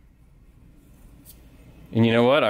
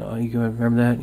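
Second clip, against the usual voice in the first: normal talking voice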